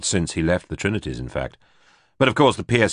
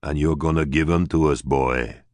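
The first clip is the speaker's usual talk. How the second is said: mild growl, lightly voiced; a hint of a menacing growl; growled threat. A narrator's reading. Teddy threatening Neville with a mild growl. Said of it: "Teddy growled".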